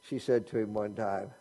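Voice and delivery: ordinary delivery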